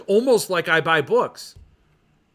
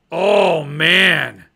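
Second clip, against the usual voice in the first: moved sigh; 8 dB louder than usual. Real, not acted. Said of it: "I'm choked up".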